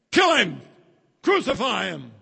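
high, loud mob shout; bay for blood like an angry mob; crowd yelling. Acted. Mob screaming at Jesus, loud high shout.

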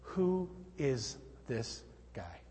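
slow, low, hushed wonder; quiet awestruck question; awed disciples. Acted. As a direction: ask it softly, word by word, astonished. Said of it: "the disciples say".

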